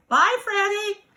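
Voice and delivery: bright high-pitched farewell; cheery high goodbye. Real, not acted. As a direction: sing out goodbye to the toy. Child toy waved goodbye.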